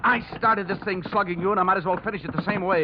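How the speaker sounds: high, hard-pressed threat mid-scuffle; tough threat. Acted. Threatening Brooks during a scuffle.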